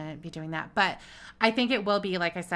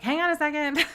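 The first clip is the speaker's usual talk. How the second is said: raised, higher-pitched self-quote; mock exasperated call; 9 semitones higher, 6 dB louder than usual. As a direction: call out to stall everyone, half-joking. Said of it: "I'm always like".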